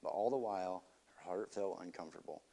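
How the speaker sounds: soft, hushed storybook reading; quiet story narration. A narrator's reading. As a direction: read it gently to a listener. Reads the story aloud.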